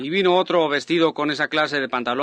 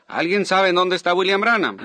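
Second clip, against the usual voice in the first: raised, higher-pitched call to a crowd; announcer calling out; 6 semitones higher, 4 dB louder than usual. Retold or imitated. Man at the microphone asks the congregation for the preacher, calling out. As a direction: call out a question over the microphone. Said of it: "él regresó al micrófono otra vez y dijo".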